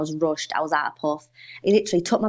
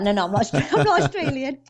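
laughing denial; 6 semitones higher, faster than usual. Retold or imitated. Correcting waiter, laughing.

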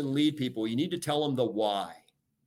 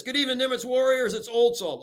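much higher and louder, bursting with cheer; upbeat announcement; 11 semitones higher, 4 dB louder than usual. Shown as a demonstration. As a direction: greet the whole crew like it's the best day ever. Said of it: "I would talk like this".

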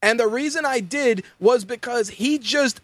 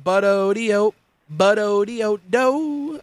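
slow, silly cartoon bird sound; lightly voiced cartoon bird; Go-Go Dodo voice; slower than usual. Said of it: "like an adult Go-Go Dodo".

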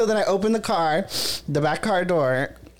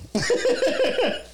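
high laugh. Real, not acted. Finishing the garage story, high laugh.

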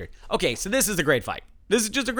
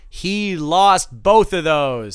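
slow dramatic reveal; 2 semitones higher, 6 dB louder, much slower than usual. Acted. Announcing a losing bet.

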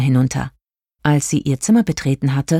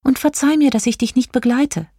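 high-pitched, apologetic fairy voice; high farewell plea; pleading star fairy; 10 semitones higher than usual. A narrator's reading. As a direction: beg pardon sweetly, voice pitched high. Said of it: "bat Jelina".

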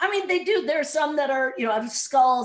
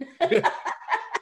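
high breathy burst of laughter; big laugh; 12 semitones higher, 3 dB louder than usual. Real, not acted. Host bursts out laughing at guest's joke, big laugh. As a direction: laugh out loud at the ruffian joke.

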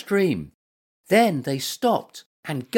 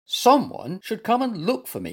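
higher-pitched, fuming complaint; mild fuming; 6 semitones higher than usual. A narrator's reading. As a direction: fume a little at being left. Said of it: "he fumed".

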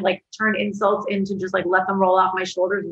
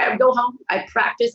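ordinary delivery

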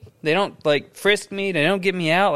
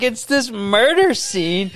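ordinary talk, nothing marked

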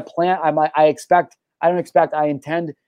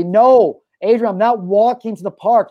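higher, louder mock protest at his friend; mock whiny refusal; 7 semitones higher, 3 dB louder, slower than usual. Refusing his friend's long walk to the park, in a higher, louder mock whine. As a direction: whine it like a stubborn kid.

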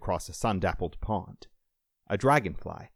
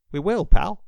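raised, trembling father's promise; loud and high, emotion unsettling the voice; 12 semitones higher, 11 dB louder than usual. A narrator's reading. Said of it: "Tom's voice trembled".

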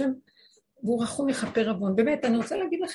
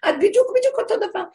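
building to a much higher, louder rebuke with a laugh; hammed-up self-reproach, faster at the end; self-scolding; 4 semitones higher, 6 dB louder than usual. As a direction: catch yourself out, rising in disbelief. Scolding herself in retelling.

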